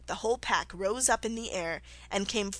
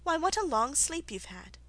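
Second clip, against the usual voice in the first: put-on amused older sister; 6 semitones higher than usual. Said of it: "said her sister".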